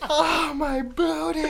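high, slow retold wail, held back; quoting through laughter. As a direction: repeat the kid's wail while fighting giggles. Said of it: "I'm still, like, not able".

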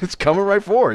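high, loud echo of a joke warning; mock alarmed shout. Storyteller playing along with co-host's joke, mock alarmed shout. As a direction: shout it in comic panic.

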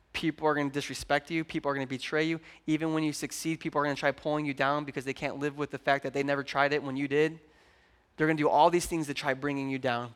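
soft, low, earnest words to his daughter; quiet and serious, like kneeling face to face with your child; gentle fatherly talk. Retold or imitated. Father on one knee telling his daughter, softly and seriously.

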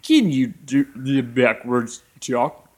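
slow, warped backwards-played speech; backwards talk. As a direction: sound like a tape played in reverse. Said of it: "I was still talking in backwards talk".

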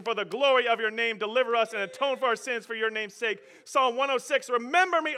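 high, loud, building read; preached scripture reading. Acted. Preacher racing through psalms for the congregation, loud.

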